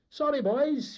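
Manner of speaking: high, loud, breezy apology; chummy sailor's excuse; affable. A narrator's reading. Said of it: "he would say affably".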